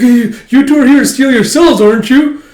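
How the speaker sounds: very high, loud, laughing accusation; accusing shout. Retold or imitated. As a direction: fire it back at them. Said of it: "It's like".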